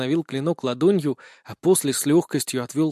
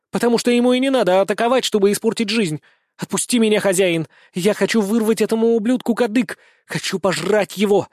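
fast, higher-pitched angry rant; furious growling; 5 semitones higher, 3 dB louder than usual. A narrator's reading. Wolf girl held back from attacking, furious high growl. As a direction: snarl it while struggling to break free. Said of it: "рычала Лина, пытаясь вырваться".

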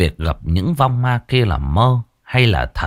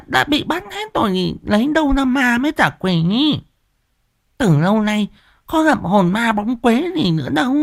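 much higher, sharp scolding wife voice; nagging old wife; 14 semitones higher than usual. A narrator's reading. Wife scolds husband for his fright, higher nagging voice. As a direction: fuss and scold like a village wife. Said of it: "Mụ Liên vẫn còn nghi ngờ".

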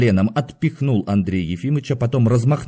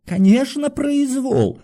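very high-pitched, strained agreement; emboldened cry; slower than usual. A narrator's reading. Doctor joins his fellow patient's protest, emboldened. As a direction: echo the protest in a high wail. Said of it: "подбодряемый криком Ивана Дмитрича".

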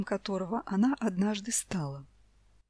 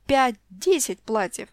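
plain reading voice

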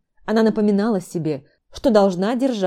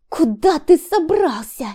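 high, loud, slow demand; wife demands where her husband is going; hoarse hiss; 9 semitones higher, 5 dB louder, slower than usual. A narrator's reading. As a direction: snap at him as he moves. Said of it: "зашипела она осипшим голосом".

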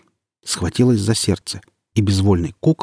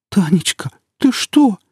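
very high wounded plea; a hurt mother on the edge of tears; mother answers her daughter's accusation; tearful hurt; 12 semitones higher, 3 dB louder, slower than usual. A narrator's reading.

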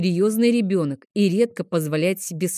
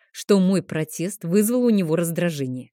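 usual speaking voice

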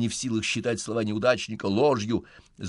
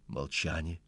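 ordinary delivery, nothing marked

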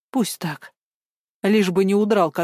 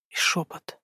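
whisper; 6 dB quieter than usual. A narrator's reading. Nightmare whisper described.

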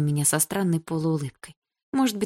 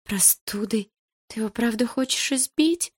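put-on higher, pleading girlish voice; plaintive girl; 6 semitones higher than usual. A narrator's reading. Malina begging Bars not to beat Vlasov, plaintive and high. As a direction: plead in a small worried voice. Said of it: "жалобно спросила Малиновская".

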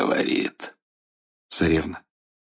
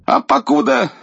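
put-on high, loud old king voice; cheeky old tsar; 3 dB louder than usual. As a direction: gloat at the villain. Царь дерзит идолу. (дерзкий старый царь)

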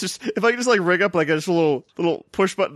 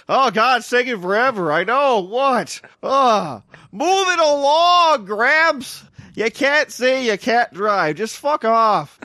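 higher, louder rude grumbling in line, drawn out; impatient queue jerk; 5 semitones higher, 3 dB louder, much slower than usual. Pretend. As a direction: heckle an old man to move along.

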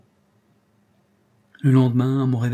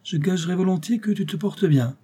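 cheerful uncle; 8 semitones higher than usual. A narrator's reading. Uncle cheerfully greets recovering nephew.

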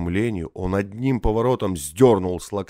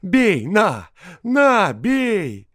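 high, loud frenzied goading; wild, defiant shouting; ferocious voice; 12 semitones higher, 7 dB louder, much slower than usual. A narrator's reading. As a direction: dare him with savage cries. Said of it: "подхватил мужик свирепым голосом".

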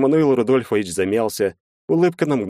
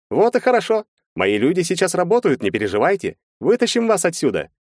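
high-pitched, animated lawyer; lawyer character; 10 semitones higher than usual. A narrator's reading. Lawyer laying out the defense to his clients, high animated lawyer voice. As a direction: voice a lawyer reasoning in high, lively tones.

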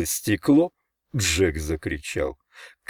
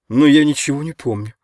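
louder, higher, sharp denial; brusque, raised-voice close; abrupt finish; 6 semitones higher, 6 dB louder than usual. A narrator's reading. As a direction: cut it off sharply. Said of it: "Резко закончил он".

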